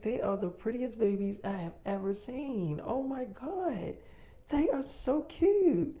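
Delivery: high, slow squealing gush that climbs; gushing over babies. Retold or imitated. Woman swooning over the baby girls, slow high squeal. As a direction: squeal it out like she can't handle the cuteness. Teller voices her gushing.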